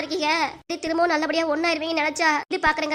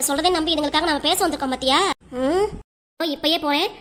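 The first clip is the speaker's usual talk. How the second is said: usual speaking voice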